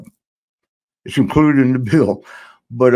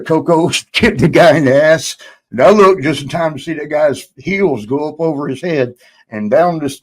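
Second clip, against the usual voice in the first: usual speaking voice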